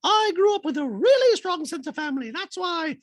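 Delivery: very high squeaky voice with beep boop robot noises; high mock robot Dorit. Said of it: "Dorit in a talking head goes".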